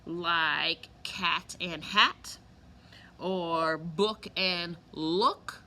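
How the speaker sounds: "cat and hat" drawn out slowly, each word stretched; slow rhyme demo. Shown as a demonstration. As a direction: stretch the rhyming pairs slowly for kids. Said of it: "Rhyme means that something sounds the same".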